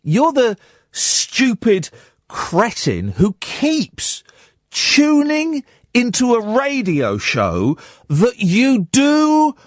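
very slow, drawn-out scorn, climbing; slow mocking scorn. Real, not acted. Radio host taunting a texter who keeps tuning in, very slow scorn. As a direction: spell it out word by word to the fool.

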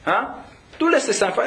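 ordinary talk, nothing marked

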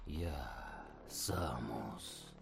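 low, wheezing gurgle of a monster; gurgling alien voice. Acted. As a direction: gurgle it out through a wheezing throat. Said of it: "хрипло дыша сквозь мускус, пробулькало существо".

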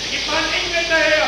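high urgent shout. Acted. Shouting over noise.

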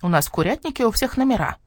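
lightly voiced hen imitation. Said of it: "куриным голосом". (скворец куриным голосом)

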